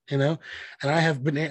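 ordinary delivery

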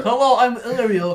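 high, loud mimicry of a friend; Ariel. Imitating a friend to her face, high and loud. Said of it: "You're supposed to be me".

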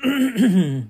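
tight throat-clear fighting back tears; choked throat clear. Real, not acted. Wishing followers happy Thanksgiving, a choked throat clear. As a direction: clear the throat as the emotion rises. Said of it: "I'm getting choked up".

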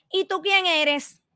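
high, slow, disdainful drawl; mocking imitation. Imitating the legislator's scornful question again. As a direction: ham up her contempt. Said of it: "Tengo que imitarla para que usted entienda".

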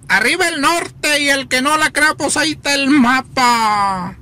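put-on high, sing-song northern Mexican accent; norteño accent. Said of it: "el norteño que dice". (acento norteño cantado y agudo)

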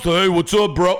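a hint of deep bro voice; mock masculine voice. Worker drops the act at breakfast, mock dude voice. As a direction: lightly imitate a casual dude. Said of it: "with that grotesque fucking masculine voice".

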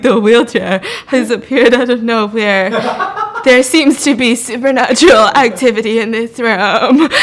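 quoting the scared ghost hunter, laughing through it; mock trembling tour line, breaking into laughter; mock shaky host voice. Retelling the terrified ghost hunter on camera, mock shaky and laughing. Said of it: "he's, like, shaking".

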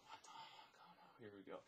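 dismayed thought in a whisper. Retold or imitated. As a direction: whisper it under your breath, stunned. Private stunned reaction while retelling.

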